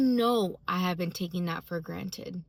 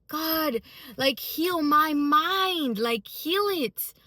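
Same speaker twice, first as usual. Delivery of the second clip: high, slow, tearful plea; 7 semitones higher, 3 dB louder, much slower than usual. Retold or imitated. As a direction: beg God slowly, voice straining. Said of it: "all of a sudden I'm like".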